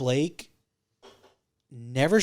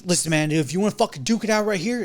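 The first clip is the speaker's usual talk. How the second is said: higher, louder tough-guy dare; challenging to fight; 8 semitones higher, 5 dB louder than usual. Retold or imitated. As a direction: square up and dare him. Mondo offering to fight.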